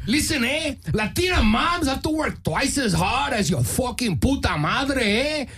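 put-on Puerto Rican-Mexican mother; Puerto Rican-Mexican mom. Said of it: "like, a Puerto Rican Mexican mom".

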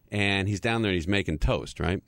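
normal talking voice

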